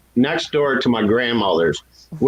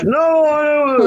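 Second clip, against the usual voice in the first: high, loud, slow frightened answer; scared teen; 13 semitones higher, 4 dB louder, slower than usual. Retold or imitated. Teen boy answering the officer at the cells, mock scared. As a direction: squeak it out like a terrified kid.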